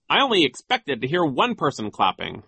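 a pompous official's reproof, a touch high; stern. A narrator's reading. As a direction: scold them with some sternness. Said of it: "he said sternly".

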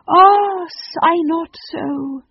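high, loud "Ah", drawn-out plea; theatrical lament. Acted. Phoenix misreading the children's sighs as grief, melodramatic. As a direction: console them melodramatically.

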